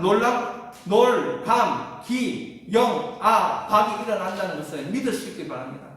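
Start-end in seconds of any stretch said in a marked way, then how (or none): 0.0-3.7 each syllable of 놀 감 기 영 박 called out apart; spelled out syllable by syllable. Shown as a demonstration. Pastor calling out the sermon acrostic to the congregation, punchy and syllable by syllable. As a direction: hit every syllable of the acrostic on its own. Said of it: "acrostic syllables called out".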